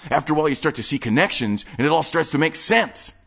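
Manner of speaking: breathless nerdy enthusiast showing off his stash; manic collector Wade. Acted. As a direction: rattle it off like an excited hoarder. Wade continues.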